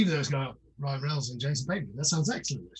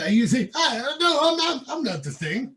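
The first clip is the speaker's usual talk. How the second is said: higher-pitched, badly faked actor impression; half-formed impression, pitched up; failed Christopher Walken; 7 semitones higher, 3 dB louder than usual. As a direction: attempt a celebrity impression and miss. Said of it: "I can't do a Christopher Walken impression".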